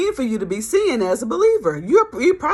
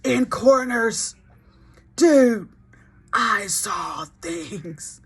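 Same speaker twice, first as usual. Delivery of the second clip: spooked, slow, dropping to a hush; haunted Fred; much slower than usual. Retold or imitated. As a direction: trail off as if still seeing it. Fred haunted, slow, fading soft.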